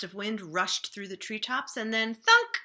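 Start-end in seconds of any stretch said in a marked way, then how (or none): 1.8-2.6 rising into a loud thunk; louder on thunk; building to a thunk. A narrator's reading. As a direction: build then punch the thunk. Pine cone falls again.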